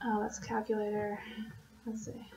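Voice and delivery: very quiet, absent self-talk; talking to oneself. Real, not acted. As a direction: murmur to yourself while searching. Talks to self hunting the calendar.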